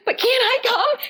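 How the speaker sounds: high, lightly voiced plea; a worried friend begging to come; pleading friend. Corinne begging the paramedic, high little pleading voice. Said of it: "She goes".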